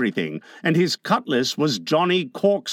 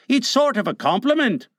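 higher-pitched henchman; a pleading question in a raised character voice; pirate Smee; 6 semitones higher, faster than usual. A narrator's reading. Smee offers his cutlass to Hook, high henchman voice.

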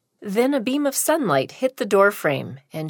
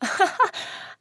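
breathy laugh; 12 semitones higher than usual. Acted. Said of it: "She laughed".

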